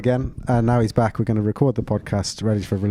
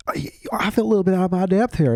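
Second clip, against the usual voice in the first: put-on higher-pitched, botched southern accent; failed southern accent; 7 semitones higher than usual. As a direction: attempt a southern accent and miss it. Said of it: "I don't know why I'm doing that accent".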